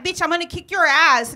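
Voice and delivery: high, loud mock threat; bully threat. Bully threatening the kid at the bus stop, high and loud. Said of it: "She's like".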